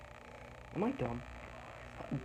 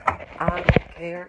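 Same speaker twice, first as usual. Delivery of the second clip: don't care; 4 semitones higher, 10 dB louder, much slower than usual. Acted. Mock-careless instructions to viewer.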